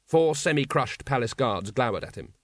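usual speaking voice

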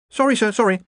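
high-pitched, hasty apology; quick apology. A narrator's reading. Ponder apologising to his bellowing superior, hurried. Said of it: "said Ponder quickly".

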